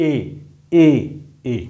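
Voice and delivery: এ repeated as a clear "e"; vowel demo. Shown as a demonstration. Teacher summing up the rule: এ said plainly. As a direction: drill the vowel এ. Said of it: "জফলার উচ্চারণ হবে".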